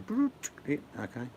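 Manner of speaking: sudden high comic voice; imitated recall noise. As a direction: squeak out a mock memory flash. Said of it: "and I go".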